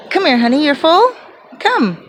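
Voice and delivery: high, sweet coaxing to a dog; coaxing pet talk. Real, not acted. Owner coaxing her dog to come back, sweet and high. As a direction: call the dog over sweetly.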